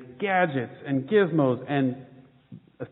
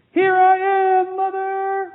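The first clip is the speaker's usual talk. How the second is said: much higher and louder, shouted up at the plane; calling out; 6 dB louder than usual. Acted. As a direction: yell up into the sky to be seen. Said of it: "he called out".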